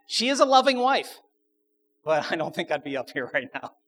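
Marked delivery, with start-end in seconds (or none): 0.1-1.2 loud, high-pitched protest; mock defence. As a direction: raise the pitch and volume in comic defence. Joking defence of wife's temper.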